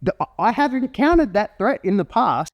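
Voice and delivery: high-pitched, incredulous protest; comic outrage as a disbelieved dog; indignant dog. Acted. Dog arguing with owner about a serious threat, high indignant protest. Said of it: "because the dog's like".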